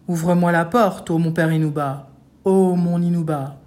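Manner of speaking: loud, heavy chanted ogre voice; ogre's big voice. A narrator's reading. Ogre at the hovel door, in his big voice. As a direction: intone it in a big, gruff ogre's voice. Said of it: "dit de sa grosse voix".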